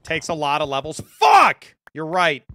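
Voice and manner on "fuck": sudden loud curse; frustrated shout. Real, not acted. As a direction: yell it out in frustration. Frustrated outburst at the cost.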